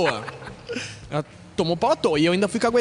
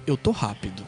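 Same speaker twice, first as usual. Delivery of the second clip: lower inner thought; 10 semitones lower, 3 dB quieter than usual. Retold or imitated. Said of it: "meu único pensamento foi".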